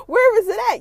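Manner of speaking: high, loud demand; demanding shout. Retold or imitated. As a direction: bark the question at her. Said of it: "He's like".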